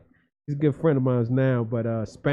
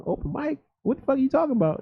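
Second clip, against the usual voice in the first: much higher, baffled; baffled self; 13 semitones higher, faster than usual. Retold or imitated. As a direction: play it clueless. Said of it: "I'm saying".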